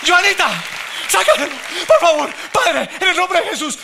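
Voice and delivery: high mock pleading prayer; imitating his wife. Husband mimicking his wife's plea, high pleading. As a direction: mimic your wife begging and praying. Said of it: "ella empieza".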